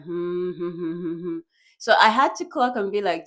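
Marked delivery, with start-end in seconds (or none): 0.0-1.4 soft repeated hmms; feigned understanding hum. Acted. Listener pretending to get the remote remark, soft humming nods. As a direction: hum along as if following, quietly. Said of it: "he's like".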